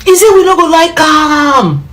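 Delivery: wailing protest. Real, not acted. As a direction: wail the complaint high, stretching the endings. Wailing protest about government threat.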